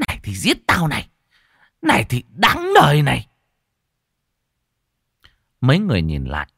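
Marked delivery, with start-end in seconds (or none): 0.1-3.3 fast, higher possessed screaming; possessed shriek. A narrator's reading. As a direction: howl it like a woman possessed. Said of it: "giơ tay cào mặt mà gào rú". (gào rú như bị vong nhập)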